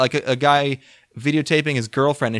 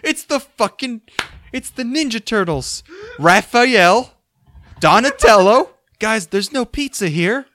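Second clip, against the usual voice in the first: mock excited calling out; 9 semitones higher, 4 dB louder, slower than usual. Imitating the Ambien voicemail guy.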